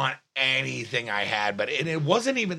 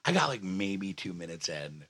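low, soft restart; toned down after yelling; 7 semitones lower, 7 dB quieter than usual. Real, not acted. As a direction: bring it right down, much quieter. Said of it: "I'm sorry I'm yelling".

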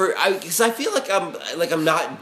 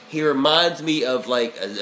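ordinary talk, nothing marked

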